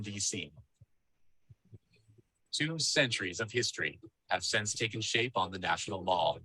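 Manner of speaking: ordinary delivery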